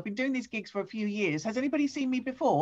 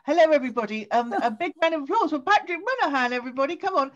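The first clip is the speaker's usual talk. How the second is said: louder, higher, hyping up the crowd; hyped host intro; 7 semitones higher, 7 dB louder than usual. Real, not acted. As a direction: whip up applause for the comic.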